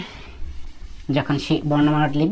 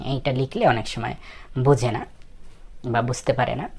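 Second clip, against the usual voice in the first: ordinary talk, nothing marked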